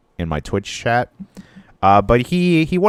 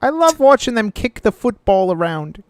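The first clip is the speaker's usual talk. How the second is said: camp it up as a cartoon Londoner; mock British accent; 3 dB louder than usual. Hosts mocking European listeners, in a hammy high British accent.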